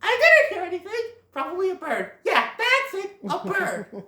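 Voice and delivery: high, hasty cover-up voice; Thumper. Acted. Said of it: "Thumper's all like".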